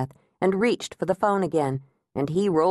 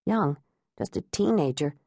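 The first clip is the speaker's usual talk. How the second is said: ordinary delivery, nothing marked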